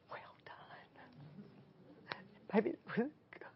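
tender, very soft whisper; hushed, whispered well done; whispered praise. Retold or imitated. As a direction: breathe it out as a loved one's intimate approval. Voices beloved's praise in a whisper.

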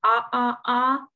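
short ah, said three times; vowel demo. Shown as a demonstration. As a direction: model the short O. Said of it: "short O in the middle".

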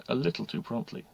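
ordinary delivery, nothing marked

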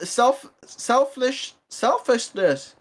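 slowly stumbling on "selfish" and "selfishness"; slow groping for "selfishness"; fumbling a word. Real, not acted. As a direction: sound out "selfishness" slowly, unsure. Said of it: "I don't know how to say that word".

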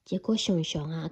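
ordinary delivery, nothing marked

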